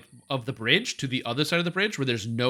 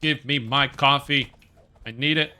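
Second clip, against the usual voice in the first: mock demand; 5 dB louder, slower than usual. Demanding coffee, joking.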